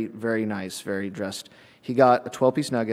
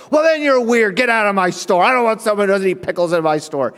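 loud, high yell at a customer; mock-furious manager laying into someone; combative manager; 6 semitones higher, 5 dB louder than usual. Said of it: "she fought with every single person".

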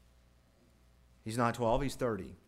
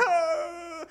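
high-pitched acted bawling wail; mock child crying; 6 dB louder than usual. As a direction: wail like a little boy, hammed-up. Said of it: "then he starts crying".

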